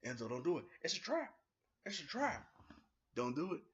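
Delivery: loud, high warning yell. Retold or imitated. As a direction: shout a warning at the screen. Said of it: "I was yelling".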